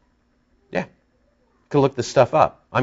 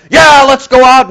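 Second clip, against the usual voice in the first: much higher, louder mock rallying cry; mock rabble-rouser; 13 semitones higher, 9 dB louder than usual. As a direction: ham up a fired-up rebel. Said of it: "I'm not trying to".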